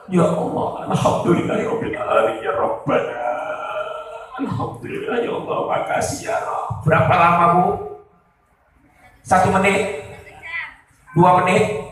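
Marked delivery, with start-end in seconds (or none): 0.1-6.8 imitating a hushed, weepy prayer of thanks; mostly voiceless sobbing thanks to God, acted out; mock weeping while praying; tearful prayer. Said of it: "Bu, nangis".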